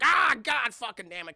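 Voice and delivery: frustrated outburst. Retold or imitated. Inventor messing up his machine, frustrated. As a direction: curse like a thwarted tinkerer.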